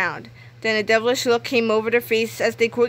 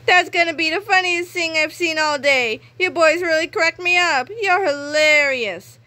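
delighted principal; 7 semitones higher than usual. A narrator's reading. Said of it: "giggling wistfully".

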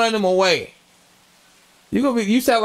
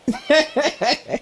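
high laugh; 8 semitones higher than usual. Real, not acted.

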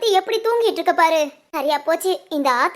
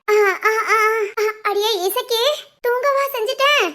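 groggy startled waking; 4 semitones higher than usual. Acted. Woken older woman startled.

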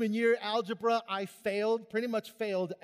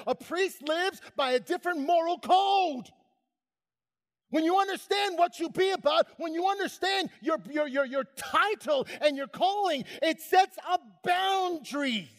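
loud, higher sermon push; preaching at full force; 7 semitones higher, 4 dB louder than usual. Acted. Preacher urging the congregation to know their calling, raised. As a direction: drive the point home to the pews.

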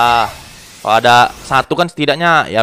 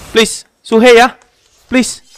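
pleading; 9 semitones higher, 3 dB louder, slower than usual. Real, not acted. Begging gacha for character.

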